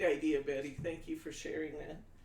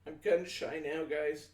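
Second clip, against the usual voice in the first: usual speaking voice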